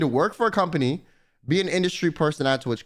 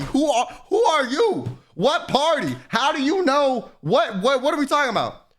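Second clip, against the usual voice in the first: confused; 7 semitones higher than usual. Retold or imitated. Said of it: "I was mad confused".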